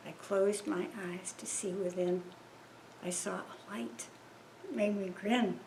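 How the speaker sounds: ordinary talk, nothing marked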